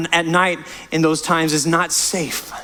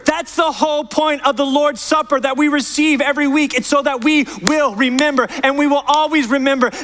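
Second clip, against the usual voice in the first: raised, driving sermon voice, pressing each point; impassioned preaching; 8 semitones higher than usual. Acted. Pastor urging the church to follow Jesus, impassioned preaching. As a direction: preach it out to the whole congregation.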